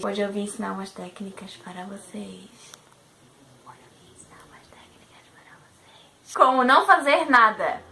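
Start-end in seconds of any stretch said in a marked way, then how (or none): 0.0-2.5 quiet. Shown as a demonstration. Repeats intro in different volumes. 3.6-6.1 soft breathy whisper, no voice. Shown as a demonstration. Repeats intro in different volumes.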